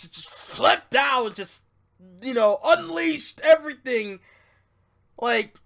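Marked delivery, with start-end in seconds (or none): none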